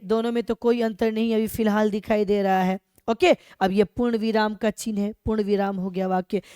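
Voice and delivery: ordinary delivery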